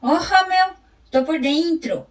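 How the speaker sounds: storyteller reading a funny Gaúcho chronicle; reading a text aloud. Acted. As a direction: perform the chronicle for the class. Continues the read text.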